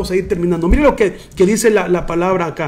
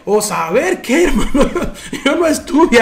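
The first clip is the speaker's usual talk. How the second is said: chuckling through the words; amused, laughter breaking into the lines; laughing; 4 semitones higher than usual. Real, not acted. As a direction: laugh along at your own joke. Laughs at own ghost joke.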